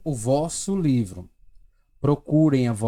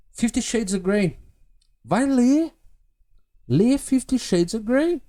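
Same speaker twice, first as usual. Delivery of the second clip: teasing; 9 semitones higher than usual. Acted. Teasing book suggestion.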